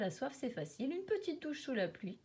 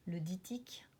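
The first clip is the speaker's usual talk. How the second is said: hushed menacing whisper; 3 semitones lower, 4 dB quieter than usual. A narrator's reading. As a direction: whisper it like a danger. Naming the tadpole's ogre.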